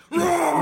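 high monster roar; terrifying roar. Acted. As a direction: roar like a beast on court. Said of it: "He makes a terrifying roar".

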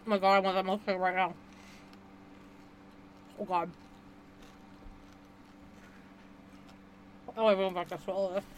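quiet, pained through the burn; forcing words past a burning mouth; mouth burning from spicy food. Real, not acted. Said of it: "I don't like that".

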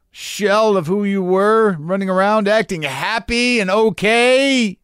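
high, slow, pleading inner voice; trapped old self. Retold or imitated. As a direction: drag it out like a trapped voice begging. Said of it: "The old one is just locked inside you going".

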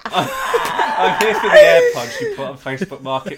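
high squealing voice cracking with laughter; can barely get the words out for laughing; laughing through it. Pretend. Friend imitating a buyer at the door, squealing with laughter.

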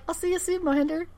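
high, soft singsong goodbye; mock sweet sign-off. Villain signs off to Mohinder before hanging up, mock sweet. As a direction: imitate a villain's teasing farewell.

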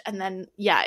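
ordinary delivery

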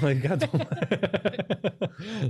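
breaking up into high laughter; laughing. Real, not acted. Joking with co-host about the client, laughing. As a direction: barely get it out through laughter. Laughing through his own joke.

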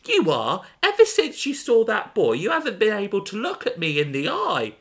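high, sharp accusing friend; Gemma's voice. Acted. Voicing Gemma in the story.

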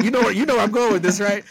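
fast, high and loud teasing; excited. Real, not acted. Teasing her friend, fast and high. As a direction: rush it out, pitch up and laughing.

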